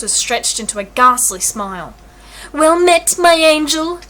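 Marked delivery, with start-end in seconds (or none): 2.5-4.0 higher, louder simper. A narrator's reading. Malvolio simpering at Olivia in yellow tights, higher and louder. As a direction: simper it like a vain suitor. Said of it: "he simpered".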